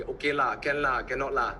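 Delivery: Malaysian English, lah on every phrase; Malaysian accent. Shown as a demonstration. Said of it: "want to speak like a Malaysian".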